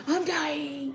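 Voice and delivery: high mock wail of a dying child; hammed-up, whiny fake dying. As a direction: imitate a kid dramatically claiming to die. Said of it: "you can say you're dying".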